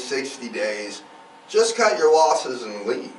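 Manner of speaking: usual speaking voice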